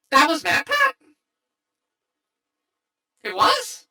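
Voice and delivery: high, disbelieving question; real shock at the news; surprised. Real, not acted. Realizing the actor's identity.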